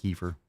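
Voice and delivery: "Kefir" said KEE-fer; "Kefir" as KEE-fer, softly; disliked pronunciation. Shown as a demonstration. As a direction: say "Kefir" with a long ee first, falling. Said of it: "I don't like kefir".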